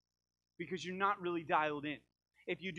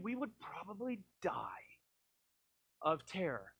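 whisper; 2 semitones higher, slower than usual. Acted. God shouting would terrify us.

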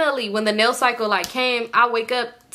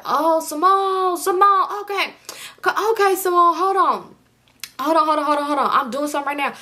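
imitated stalling boyfriend; 7 semitones higher than usual. Boyfriend fending off her angry phone call, high mock stalling. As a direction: put him off in an exaggerated high voice.

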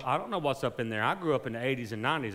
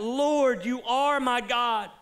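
high, loud, slow preaching cry; full-force preaching; 10 semitones higher, 4 dB louder than usual. Acted. Pastor urging the congregation to trust God, loud slow preaching. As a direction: preach it with fire, slowly.

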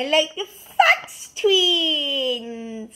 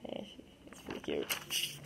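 soft unvoiced whisper; whispered aside; 18 dB quieter than usual. Real, not acted. Kid softly whispering about her cute plush. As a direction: whisper it to yourself.

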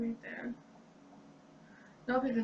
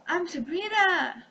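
excited gasp; 6 semitones higher, 6 dB louder than usual. Pretend. Filter makes her Sabrina.

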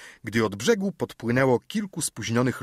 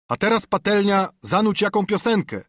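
much higher, raised voice; calling out; 9 semitones higher, 4 dB louder than usual. A narrator's reading. Sailor asking the cook for a song, calling out. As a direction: call merrily across the deck for a tune. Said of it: "zawołał jakiś głos".

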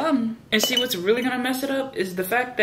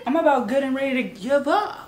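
ordinary delivery

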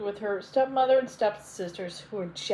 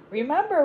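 higher, louder warning word; a raised, cautioning remember; 6 semitones higher, 4 dB louder than usual. A narrator's reading. Godmother warns Cinderella before the ball, cautioning. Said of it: "warned the fairy godmother".

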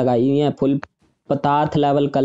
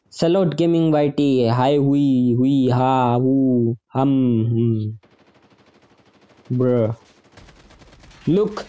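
normal talking voice

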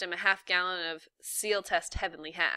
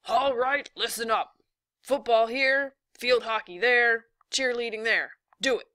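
athletic director shouting orders; yelling; 5 semitones higher, 7 dB louder than usual. A narrator's reading. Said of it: "blew a whistle and yelled".

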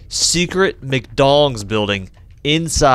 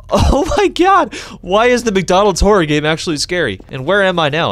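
frightened; 8 semitones higher, 4 dB louder than usual. Real, not acted. Player startled by the horror game, crying out. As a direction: cry out in real fright.

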